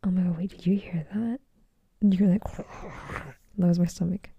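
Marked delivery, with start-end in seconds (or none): 0.0-1.4 whispered quote. Retold or imitated. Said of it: "It's like".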